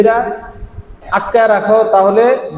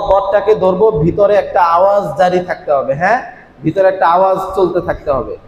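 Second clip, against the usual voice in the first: usual speaking voice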